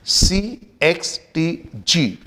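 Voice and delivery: normal talking voice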